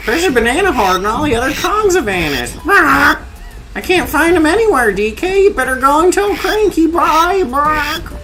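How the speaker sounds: high squeaky character read; high cartoon voice. Reading in-game dialogue in voice.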